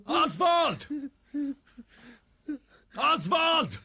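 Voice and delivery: loud, high-pitched yell of a name; calling out. Acted. Calling out for Oswald, loud high shout. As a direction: shout the name to summon someone.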